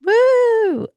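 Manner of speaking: high, loud, sustained "woo"; celebratory whoop. Real, not acted. Co-host cheering a milestone hockey goal, celebratory whoop.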